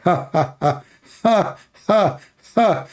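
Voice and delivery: hammed-up evil ha ha ha, rising at the end; mock devil laugh. Devil mocking you from inside your mouth: hammed-up evil laugh. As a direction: cackle like a gleeful devil, lightly acted. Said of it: "He will start laughing like".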